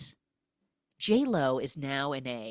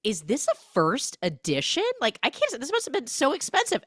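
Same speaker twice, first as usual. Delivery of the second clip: higher, gushing disbelief; 10 semitones higher, 3 dB louder than usual. Retold or imitated. [name] gushing over the Iliad, high and excited. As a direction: gush over the gift breathlessly. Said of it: "she goes".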